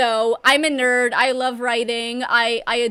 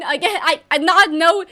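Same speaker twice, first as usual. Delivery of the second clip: usual speaking voice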